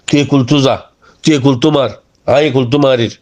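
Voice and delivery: ordinary delivery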